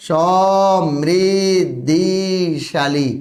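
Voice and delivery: সমৃদ্ধিশালী stretched very slowly, the ি sound held; teacher slowly modelling সমৃদ্ধিশালী; slow correct spelling. Shown as a demonstration. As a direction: draw out সমৃদ্ধিশালী for the class. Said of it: "বানানটা হবে কি বলো তো?".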